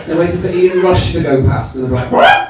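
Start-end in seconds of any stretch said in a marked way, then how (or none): 2.1-2.5 very high, loud mock squawk; startled chicken reaction.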